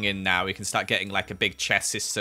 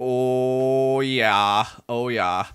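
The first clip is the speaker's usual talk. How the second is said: loud, drawn-out mock German accent; 5 dB louder, much slower than usual. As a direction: slip into a playful German accent, loud and long. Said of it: "gone for a German accent there".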